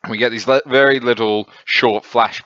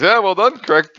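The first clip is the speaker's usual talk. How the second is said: smug approval; 10 semitones higher, 4 dB louder than usual. Retold or imitated. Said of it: "laughs at it and goes".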